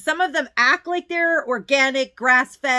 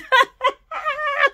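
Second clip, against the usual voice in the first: emotional laugh. Real, not acted. Moved by the poem.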